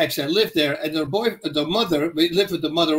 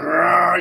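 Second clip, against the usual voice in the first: rolling mock Rottweiler growl; imitated dog roar; 4 dB louder than usual. Rottweiler turning on the storyteller, imitated roar. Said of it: "he goes".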